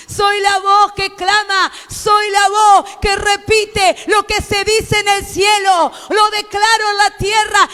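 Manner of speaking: sube de tono hasta gritar, voz lenta al final; proclámalo como un predicador en éxtasis; predicación a gritos. Acted. Predicación a pleno. (predicador gritando con fervor ante la iglesia)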